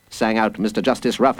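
usual speaking voice

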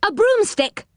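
insistent high call; loud call. A narrator's reading. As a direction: pitch it high to be heard through a door. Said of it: "said Moonface loudly".

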